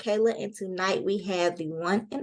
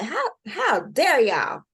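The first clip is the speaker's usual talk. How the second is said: mock indignation; 7 semitones higher, 4 dB louder, slower than usual. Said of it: "And I was like".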